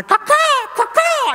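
high, loud cawing like a crow outside the window; mock bird caw. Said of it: "you hear them outside going".

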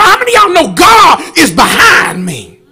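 loud, rising gospel preaching; full-force preaching. Acted. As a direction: preach it hard to the congregation. Preacher calls to congregation.

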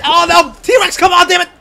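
high, loud plea; exasperated shout. Real, not acted. Player urging T-Rex on, exasperated shout. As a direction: yell in dismay at a teammate.

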